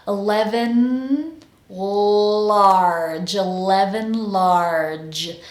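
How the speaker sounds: "eleven, large" slowly, holding the tongue from N into L; N linked to L. Shown as a demonstration. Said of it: "Now to connect N to L".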